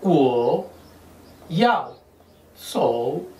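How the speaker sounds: Wo yao sou word by word with pauses; spaced-out repetition; slow repeat. Shown as a demonstration. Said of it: "Se lo repito".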